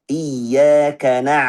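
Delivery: very slow Iyyaka na, ain flowing; correct iyyaka na. Shown as a demonstration. Teacher models the flowing ain, very slow. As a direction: draw out Iyyaka na. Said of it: "Bukan na".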